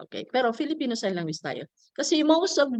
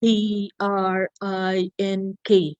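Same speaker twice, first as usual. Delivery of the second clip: each letter said slowly and apart; drawn-out finger-spelling letters; slow spelled letters; much slower than usual. Shown as a demonstration. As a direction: spell it out one letter at a time. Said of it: "do not do like this".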